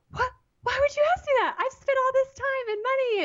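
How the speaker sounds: high, loud, stunned protest; indignant disbelief. Retold or imitated. Wife reacting to her husband's question, indignant and stunned. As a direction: act out being shocked by the question.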